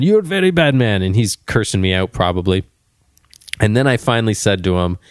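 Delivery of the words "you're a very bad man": mock Indian accent, higher. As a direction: repeat it even higher in the put-on Indian accent. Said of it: "I said to him with a Indian accent".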